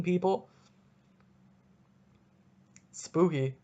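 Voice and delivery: normal talking voice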